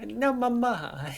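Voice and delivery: slow, high, hands-in-the-air plea; dramatic Italian son. Retold or imitated. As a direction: plead to mama like an Italian son. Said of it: "Throw my hands up in the air".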